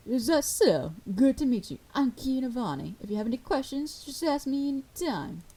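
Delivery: welcoming bar worker. Acted. Bar worker greets the new bartender, welcoming. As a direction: introduce yourself in a lifted, easy voice.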